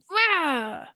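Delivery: high-pitched playful "rah" of a riled-up self; mock angry roar. Coach voicing her triggered human self, mock angry roar. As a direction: act out a quick high growl of protest. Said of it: "my human self is like".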